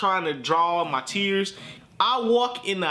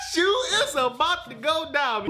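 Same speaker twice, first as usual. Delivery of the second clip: high, thrilled shout; excited; 10 semitones higher than usual. Retold or imitated. Boy hyped for revenge, high thrilled. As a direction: hype it up. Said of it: "So I'm like".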